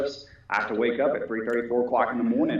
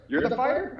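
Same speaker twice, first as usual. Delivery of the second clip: retold, much higher rising question; mock the anchor's surprise; puzzled newsman voice; surprised question; 12 semitones higher than usual. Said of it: "He looked at me and goes".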